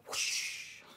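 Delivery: voiceless loud swooshing noise; imitated whoosh. Shown as a demonstration. Vlogger miming an inserted picture appearing, whooshed. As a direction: make a breathy swoosh effect.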